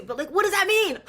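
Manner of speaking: high, loud incredulous question; baffled disbelief. Retold or imitated. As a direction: laughingly demand an explanation. Acting out her sceptical bafflement.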